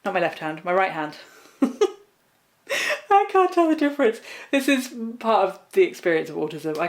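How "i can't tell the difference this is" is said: laughing. Real, not acted. Laughs at own left-right mix-up.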